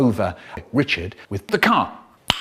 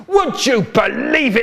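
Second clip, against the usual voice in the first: a high shouted wail; roaring; 8 semitones higher than usual. Acted. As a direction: bellow it out in outrage. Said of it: "Then Eileen Ogle roaring".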